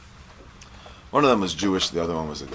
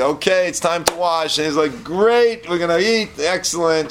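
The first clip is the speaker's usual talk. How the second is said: eager excitement; 12 semitones higher, 8 dB louder than usual. Retold or imitated. Hungry beggar finally reaching the meal.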